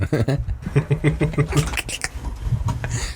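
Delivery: silent breathy wheeze of laughter; quiet wheezing laugh. Real, not acted. Laughing at his friend's joke, silent wheeze. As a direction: wheeze with laughter, barely voiced.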